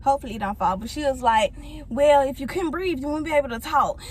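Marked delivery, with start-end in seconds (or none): 1.9-4.0 louder, dismissive mock retort; imitating rude nurse. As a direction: mimic a nurse being rude to a patient. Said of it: "Like, she was just so rude".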